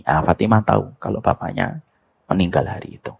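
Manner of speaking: usual speaking voice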